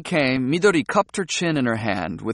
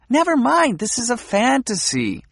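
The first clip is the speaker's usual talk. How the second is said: impatient dismissal; 10 semitones higher than usual. A narrator's reading. Midori cutting him off.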